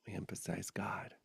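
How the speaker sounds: hushed, devotional whisper. Real, not acted. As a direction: breathe it out reverently. Soft devotional closing words.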